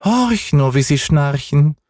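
hoch und gedehnt gerufen; kleine Katze. Acted. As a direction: von oben verspielt herabrufen. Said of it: "rief die kleine Katze herunter". (hohe, langsame Katzenstimme)

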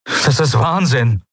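high, drawn-out outburst; exasperated cry. A narrator's reading. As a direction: cry out at the madness, pitch up. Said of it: "rief er".

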